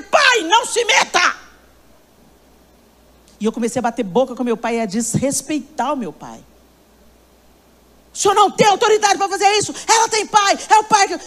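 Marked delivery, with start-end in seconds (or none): 0.0-1.4 higher, slow, sharp shout; angry retort. Retold or imitated. Daughter shouting at her father to stay out, angry. 8.1-11.2 higher, fast heated protest; heated argument. Retold or imitated. Daughter arguing with her father about authority, heated.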